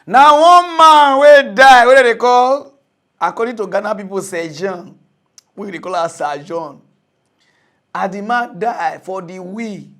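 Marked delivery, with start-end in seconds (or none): none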